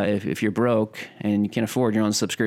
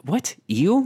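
a startled, disbelieving repeat; baffled; 8 semitones higher than usual. Real, not acted. Baffled at friend's word.

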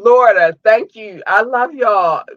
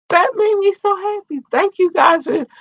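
happy gush; 13 semitones higher than usual. Real, not acted. Happy thanks to viewers.

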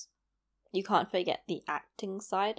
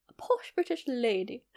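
lightly voiced posh British lady, softer; a hint of upper-class British, "A posh British lady" said with a genteel lilt; posh British accent; 3 semitones higher, 4 dB quieter than usual. Shown as a demonstration. Vlogger demoing her posh British lady voice reel character, light posh British accent. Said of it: "quite a very British, like a posh British".

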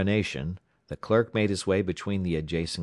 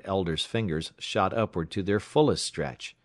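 usual speaking voice

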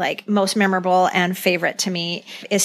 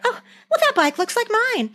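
mock innocent Jan; 13 semitones higher than usual. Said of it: "already apologized for".

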